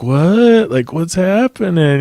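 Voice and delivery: high, rising bewildered drawl; drunk and confused. Retold or imitated. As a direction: imitate a dazed drunk. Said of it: "he was so drunk".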